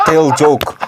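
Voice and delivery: mock Russian accent, curt commands; Putin impression. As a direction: order it in hammed-up Russian English. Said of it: "Putin himself will come, like".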